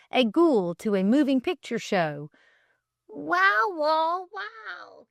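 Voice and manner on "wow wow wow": high dog howl, rising wow; howling wolfhound. Acted. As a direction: howl along like the singing wolfhound. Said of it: "caroled beautiful Lovely".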